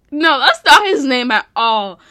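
loud, emphatic denial; exasperated self-correction. Real, not acted. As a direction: burst out correcting yourself. Catches herself on wrong name.

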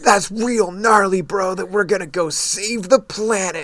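mock the obnoxious American dude; eighties bro. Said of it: "He's like an eighties bro".

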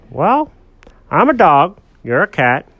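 emphatic dog explaining to his buddy; dog character voice. Acted. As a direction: spell it out as an earnest cartoon dog.

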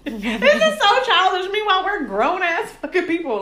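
mock-exasperated laughing line; laughing at herself. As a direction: giggle it out, embarrassed. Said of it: "how childish are we".

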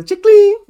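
high, loud ka-ching sound; cash register ding. Pretend.

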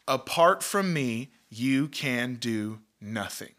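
preacher underlining the verse; slow emphatic scripture. Acted. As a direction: weigh every word of the verse. Reading the key verse slowly.

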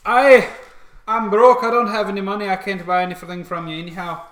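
high, loud plaintive character voice; Porky's voice. Acted. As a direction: cry out being broke. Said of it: "So Porky says".

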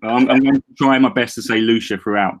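normal talking voice

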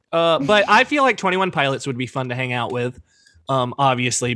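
ordinary talk

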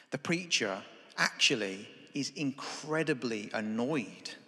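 normal talking voice

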